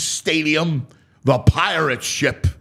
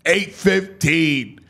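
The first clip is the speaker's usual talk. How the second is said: usual speaking voice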